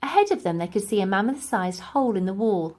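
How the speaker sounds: ordinary delivery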